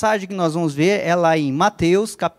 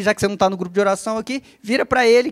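ordinary talk, nothing marked

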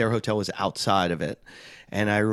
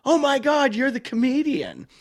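excited fan squeal; 14 semitones higher, 4 dB louder than usual. Retold or imitated. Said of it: "she looks at me and she goes".

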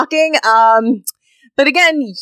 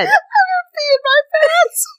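real helpless high squeal of laughter; breaking up completely, words squeezed high; laughing hard. Real, not acted. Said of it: "She's laughing so hard".

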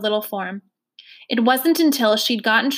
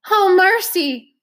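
1 alarmed cry; 8 semitones higher, 6 dB louder, slower than usual. A narrator's reading. Woman falling down, crying out.

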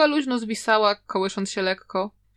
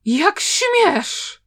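loud, high burst of indignation; angry exclamation, pitched high and loud; outraged old master; 7 semitones higher, 5 dB louder than usual. A narrator's reading. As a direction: explode in outraged disbelief. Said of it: "ze złości aż trzęsła się".

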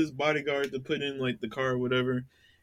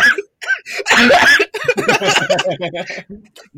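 high, loud drawn-out laughter; helpless laughing fit; long laugh; 11 dB louder than usual. Real, not acted. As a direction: roar with laughter.

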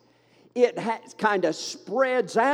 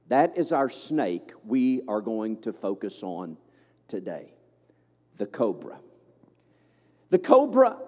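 normal talking voice

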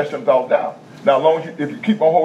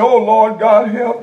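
high-pitched, crying plea in parody; mock whimpering; 5 semitones higher, 3 dB louder, slower than usual. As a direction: imitate a whimpering believer begging for help. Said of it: "going in there whimpering and crying".

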